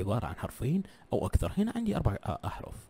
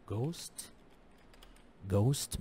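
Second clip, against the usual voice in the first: slow word demo. Shown as a demonstration. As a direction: draw out "ghost". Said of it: "إيش معنى كلمة ghost؟". (نطق "ghost" ببطء ووضوح)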